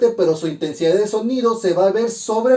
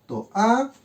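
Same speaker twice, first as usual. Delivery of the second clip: "To, a" as two separate beats, pitched up; split into syllables; 4 semitones higher than usual. Shown as a demonstration. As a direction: clear gap between "To" and "a". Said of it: "se separan".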